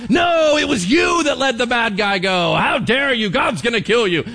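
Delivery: high, loud shouted rebuke; furious prophet. Acted. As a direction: denounce the king at full volume. Prophet unmasks and condemns the king.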